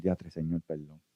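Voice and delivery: a quiet broken plea for forgiveness; tearful plea. Retold or imitated. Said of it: "pegas a llorar y pedir". (súplica llorosa y muy suave)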